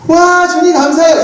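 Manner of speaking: mock excited Jeremiah. Pastor playing an overjoyed Jeremiah answering God, hammed-up excitement. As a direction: gush like a thrilled kid called by God. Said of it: "예레미야의 대답은 뭘까?".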